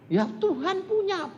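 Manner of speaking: high, anxious objection; protesting boatman. Retold or imitated. Frightened boatman objects to the doctor, high anxious protest. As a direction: plead back in a frightened high voice.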